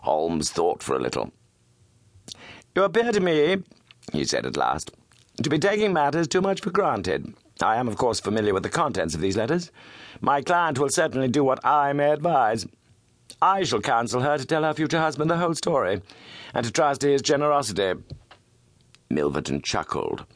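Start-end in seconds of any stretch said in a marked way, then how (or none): 2.7-3.6 Holmes voice. Acted. Holmes confronts Milverton. 5.4-18.0 steady, resolute gentleman detective; lightly voiced, cool Holmes; firm detective. Holmes confronts Milverton.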